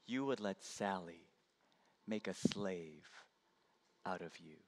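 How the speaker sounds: soft, low, gentle forgiveness; tender grandma. Acted. Grandma kneels and forgives Johnny, soft and tender. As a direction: hold his hands and speak softly.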